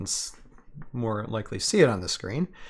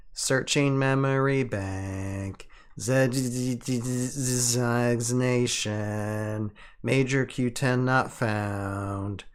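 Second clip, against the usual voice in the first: slow, even machine readout; robot voice; much slower than usual. Acted. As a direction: read it like a lazy robot. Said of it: "You gotta do the robot voice".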